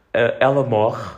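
ordinary talk, nothing marked

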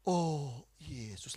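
"Yesus" drawn out in a low, voiced prayer; low, stretched-out devotional call; slow prayer. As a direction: imitate slow, lingering worship on "Yesus". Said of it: "Lebih lambat lagi".

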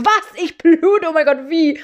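high, loud panicked disbelief; panicked shock. Retold or imitated. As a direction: squeal it like you just saw blood. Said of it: "Ich so". (hoch, laut und panisch geschockt)

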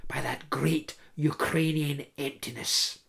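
whispered narration; hushed. A narrator's reading. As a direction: let the words vanish into a whisper. Voice eaten up by emptiness.

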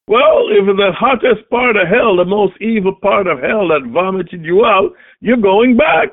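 fiery preaching. Acted. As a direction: thunder the threats from the pulpit. Preaching David's threat at full force.

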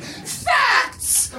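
high screeched one-word answer; bellowing. A narrator's reading. Edna answers Tootie's panicked question, bellowing. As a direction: screech it high like an exasperated outburst. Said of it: "bellowed Edna".

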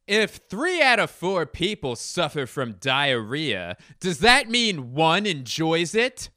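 high, loud, deliberate stand-up impression; hammed-up, stretched joke delivery; John Mulaney. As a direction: project the setup slowly in a put-on comedian voice. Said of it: "This is John Mulaney".

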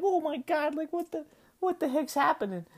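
high, shrill-free panicked squeak of disbelief. Retold or imitated. Running under the garage door, panicking at the sirens, high startled disbelief. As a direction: squeal it out like the sirens just caught you. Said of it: "And I'm like".

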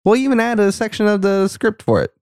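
high squeaky producer voice; mock producer voice. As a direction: pitch way up as an eager producer. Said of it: "and they said".

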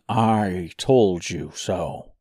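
slow smug told-you-so. Acted. Imagined gloating quote.

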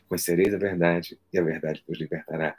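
scripture reading. Acted. Quoting scripture solemnly.

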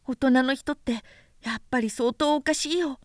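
put-on light, high boyish voice, curious; a small boy asking innocently; little prince voice. A narrator's reading. Little prince asking the vain man, high childlike voice.

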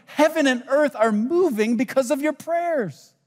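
high-pitched, impassioned proclamation; angel's proclamation. Acted. Angel telling Daniel about his prayers, high proclamation. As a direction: proclaim it high, all in one sweep. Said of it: "The angel's saying to Daniel".

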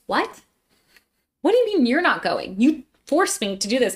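high-pitched, incredulous protest; indignant disbelief. Retold or imitated. Student to doctors who backed out, indignant disbelief. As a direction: call them out in mock outrage. Retold protest at friends backing out.

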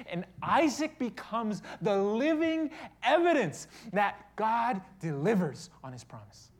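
loud, high, full-force sermon; ringing pulpit proclamation, pitched high; impassioned preaching. Acted.

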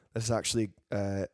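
normal talking voice

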